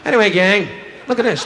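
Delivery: usual speaking voice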